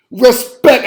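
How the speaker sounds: "Respect" with a crisp, hit K sound at the end; hard K in respect. Shown as a demonstration. Host demanding respect for Tomlin, "Respect" with a sharp K. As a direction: punch the K in "Respect", no T. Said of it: "I want a K on that end of it".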